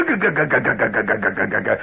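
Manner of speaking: imitated rattling car, fading; car shimmy noise. Mimicking the old car over railroad tracks, rattling. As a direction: sputter it out like a shaking car. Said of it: "And it would go".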